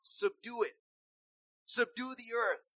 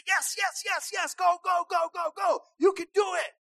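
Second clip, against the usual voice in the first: excited cheering; 6 semitones higher, 5 dB louder than usual. Acted. Parent cheering child on.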